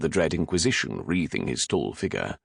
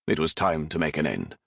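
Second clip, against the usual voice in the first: plain reading voice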